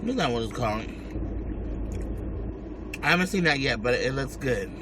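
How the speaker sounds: ordinary talk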